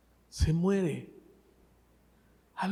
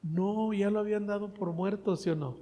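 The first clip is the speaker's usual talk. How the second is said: usual speaking voice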